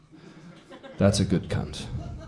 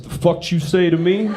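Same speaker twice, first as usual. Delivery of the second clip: higher, mock-aggressive retort; offended challenge; 6 semitones higher, 3 dB louder than usual. Comic voices his offended drinking buddy, mock anger. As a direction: imitate a guy picking a fight. Offended friend squares up to him.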